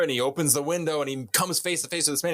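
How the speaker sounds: ordinary talk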